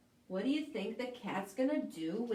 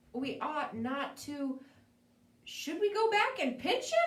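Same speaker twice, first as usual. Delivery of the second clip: usual speaking voice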